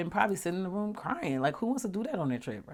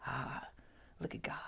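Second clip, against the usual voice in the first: awed hush; 13 dB quieter than usual. Retold or imitated. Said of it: "it was like".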